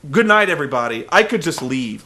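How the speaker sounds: normal talking voice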